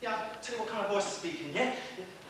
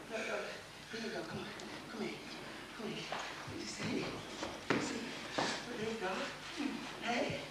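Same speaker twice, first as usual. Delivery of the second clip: soft, coaxing voice; gentle reassurance; 10 dB quieter than usual. Acted. Speaker coaxes someone to come here, softly reassuring. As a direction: soothe quietly in short, patient phrases.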